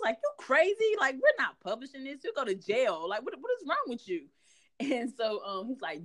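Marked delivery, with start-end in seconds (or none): 0.2-4.3 high, disbelieving protest; incredulous. Retold or imitated. Wife protesting to husband about publishing, high incredulous. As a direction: throw it back at him like he's lost his mind. She quotes herself protesting.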